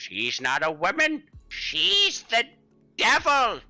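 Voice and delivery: put-on high, wailing old-man voice, slow; a frantic, bone-robbed geezer crying out; shrill hysterical wail of a ranting elder; crazed old man.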